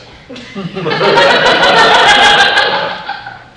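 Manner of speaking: loud hearty laugh; big stage laugh. Acted. Rosalind mocks lover's marks.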